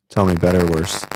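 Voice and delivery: loud and clear question; calling out. Real, not acted. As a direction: project it across a bad line. Checking audio with remote caller.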